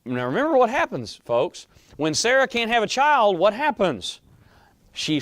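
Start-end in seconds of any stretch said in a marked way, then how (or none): none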